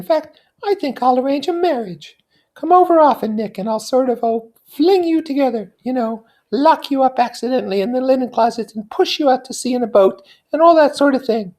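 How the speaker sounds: put-on high, light young society woman; Daisy's voice. Daisy confiding in her cousin Nick, high and light. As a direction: lift into a bright, high feminine voice. Daisy teases about marriage.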